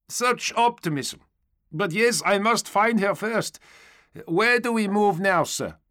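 high, lively trooper voice; blithe stormtrooper. A narrator's reading. Stormtrooper asking the knight where next, high upbeat voice. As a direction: keep it high and upbeat, ready for orders.

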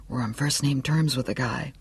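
hushed inner thought with a touch of hysteria; a little hysterical. A narrator's reading. Johnny thinking about being on first-name terms with Stilson, understated hysteria. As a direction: think it to yourself, softly, mildly hysterical. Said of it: "Johnny thought a little hysterically".

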